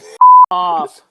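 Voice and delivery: a sudden loud mock yell of the curse; mock shouted curse. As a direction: blurt it out loud, a hammed-up cutoff. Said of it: "He's like".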